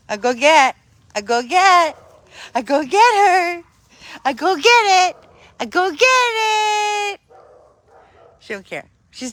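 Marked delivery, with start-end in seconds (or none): 0.1-7.2 rising higher and higher each time; playful dog talk. Real, not acted. Owner egging Susie to fetch, building high playful talk. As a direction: wind the dog up, building excitement.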